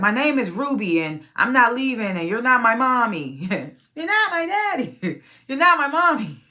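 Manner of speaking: put-on high-pitched little kid protest, building; mock a child refusing to go, pitch climbing; defiant child. Voices defiant child refusing mother.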